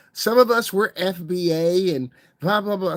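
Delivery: high, slow mocking voice of a commenter; drawn-out put-on sneer at the critic; mocking quote. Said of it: "They also mentioned".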